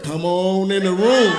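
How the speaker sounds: slow drawn-out welcome; slow invitation. Retold or imitated. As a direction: draw it out slowly. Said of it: "Like my brother said".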